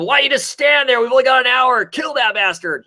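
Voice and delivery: high-pitched, urgent yelled order; retold yell. Mob boss orders his idle crew after Joker, mock yelling. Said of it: "yells at him".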